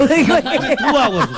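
slow, drawn-out laughing taxi driver English; taxi driver accent. Retold or imitated. Host imitating a taxi driver, slow laughing accented English. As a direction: stretch it out, cracking up, as the cabbie. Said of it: "Los taxistas en Nueva York".

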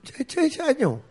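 high, loud parody of a drilled recruit; mock soldier voice. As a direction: ham up the soldier answer. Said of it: "Entonces yo dije".

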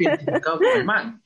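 laughing. Real, not acted. Admitting to the podcast friends with a laugh. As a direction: giggle it out, a little embarrassed. Confessing love of narco music.

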